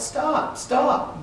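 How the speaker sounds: high pleading cry of stop, stop; trying to shout. A narrator's reading. Girl tries to stop the firemen hosing marchers, high cry. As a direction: call out stop, stop, pitched high. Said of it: "I tried to shout".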